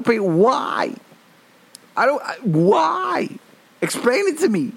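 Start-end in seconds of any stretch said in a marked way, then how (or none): 2.0-4.7 mock anguish. Ranting at Home Depot.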